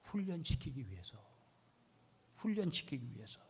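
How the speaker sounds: very soft, near-whispered answer; hushed emphasis. Real, not acted. Preacher reveals the reason to congregation, hushed. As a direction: drop right down for the key point.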